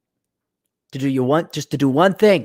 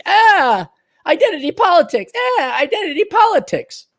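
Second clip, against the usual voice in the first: very high-pitched mock wail; mocking whine; 12 semitones higher than usual. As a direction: parrot it in a silly high voice. Mocking corporate one-trick pony.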